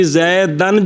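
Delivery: زیدن ending in a short "a", zaidan; fatha ending. Shown as a demonstration. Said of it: "یہ جو فرق پڑ رہا ہے".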